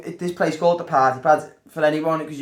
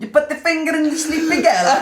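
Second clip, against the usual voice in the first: mock Italian accent; 10 semitones higher than usual. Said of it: "the Italian Bill Cosby".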